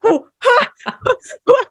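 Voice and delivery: high, breathy stunned huhs. Retold or imitated. Reacting to a guide's sudden dope slap, stunned high huhs. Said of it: "And I was like".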